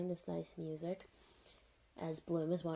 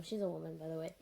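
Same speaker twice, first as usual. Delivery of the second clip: normal talking voice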